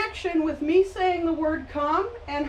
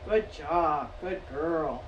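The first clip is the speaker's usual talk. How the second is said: soft praise; 10 semitones lower, 9 dB quieter, slower than usual. Real, not acted.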